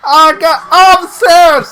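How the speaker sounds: very high, loud, slow wailing shout; mock agonised yell. Co-host voicing wrestler hit by stairs, mock agony. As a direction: howl it as if badly hurt, hammed up.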